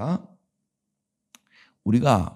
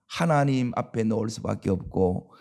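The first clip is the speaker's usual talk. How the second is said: normal talking voice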